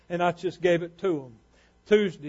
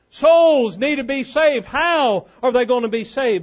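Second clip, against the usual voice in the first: emphatic, raised preacher's delivery; impassioned preaching; 6 semitones higher, 6 dB louder than usual. Acted. Preacher tells church what it really needs, impassioned preaching. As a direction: drive each point home from the pulpit.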